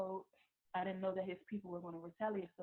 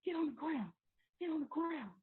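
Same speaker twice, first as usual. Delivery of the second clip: loud, high barked orders, repeated; retold shouted police command; police yelling commands; 9 semitones higher, 5 dB louder than usual. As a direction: yell it like a cop forcing someone down. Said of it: "like they told him to get on".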